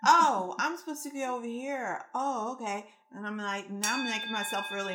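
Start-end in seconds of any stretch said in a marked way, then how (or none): 0.0-2.9 high-pitched exasperated voice that suddenly gets it; exasperated universe. Retold or imitated. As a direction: play the fed-up universe finally noticing. Universe voiced, exasperated then realizing.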